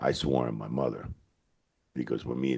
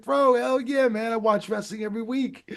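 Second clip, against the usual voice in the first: imitating a boxer's high, light voice, raised well up; Mike Tyson high voice; 5 dB louder than usual. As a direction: squeak it out like a star fan gushing. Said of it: "real pitch voice".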